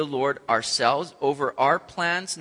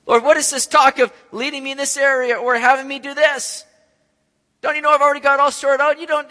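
high, loud, fast indignant rant; a high, loud, fast complaint to God; rebuking the Lord; 8 semitones higher, 6 dB louder than usual. Retold or imitated. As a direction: tell God off. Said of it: "we're essentially rebuking the Lord".

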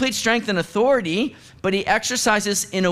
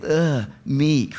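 a lightly voiced disdainful ugh, meek; dismissive scoff; 4 semitones lower than usual. Preacher mocking how people picture meekness, with a disdainful scoff. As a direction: scoff at the weakling idea.

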